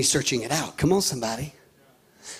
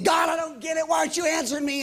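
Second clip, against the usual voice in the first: exasperated cry to God, pitched up; frustrated plea; 7 semitones higher, faster than usual. Retold or imitated. Pastor venting at God for not answering prayers.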